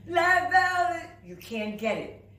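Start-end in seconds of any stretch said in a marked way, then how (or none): 0.1-1.0 pinched voice. Shown as a demonstration. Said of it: "your mouth is closed".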